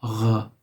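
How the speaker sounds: Gh said as a French R, rasped in the throat; Gh, a throaty French R from the back of the throat. Shown as a demonstration. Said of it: "it has the French R sound".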